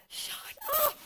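shrill high shout at the enemy; mock shout. Telling the enemy to shut up, high sharp shout. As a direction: snap it out, way up high. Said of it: "sometimes I'll be like".

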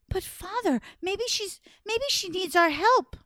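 high, pleading and fast; worried princess. Acted. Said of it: "Show me how worried she was".

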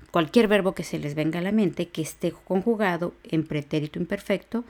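usual speaking voice

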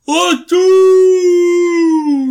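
sudden huge dramatic shout; booming doom. Pretend. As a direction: boom it like a movie trailer. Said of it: "Can you not do that?".

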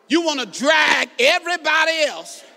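emphatic preacher's shout; forceful preaching. Real, not acted. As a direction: drive it out to the congregation. Preacher hammering his point.

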